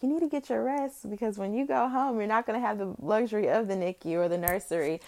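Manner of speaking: high, sing-song imitation of a nurse; mock nurse voice. Nurses telling new mother to rest, mock high voice. And they're like.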